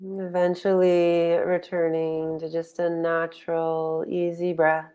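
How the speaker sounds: unhurried guiding voice with long pauses; guided meditation. Real, not acted. Meditation teacher settling online group into posture and breath, guided meditation. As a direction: ease them into stillness, phrase by phrase.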